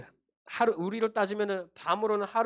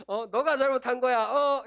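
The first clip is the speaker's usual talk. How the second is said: raised-pitch accusing parent; mock parental scolding; 4 semitones higher than usual. Parent blaming the lost child, mock angry. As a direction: push the blame, ending on a higher demanding "어?".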